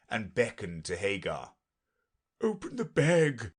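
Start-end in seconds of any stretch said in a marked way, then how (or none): none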